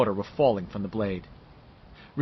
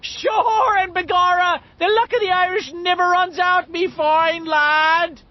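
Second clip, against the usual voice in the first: high, slow, loud hammed-up Irish brogue; Irish cop voice; 6 dB louder, slower than usual. Richie mocking Henry in a loud Irish brogue. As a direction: bugle it like a stage Irish cop. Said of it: "in his Irish cop's voice".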